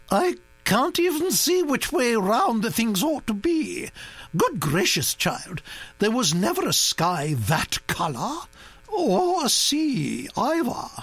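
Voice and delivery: put-on high, scornful aristocratic old lady; disapproving old duchess. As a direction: scoff at the paintings grandly. Duchess criticises the paintings.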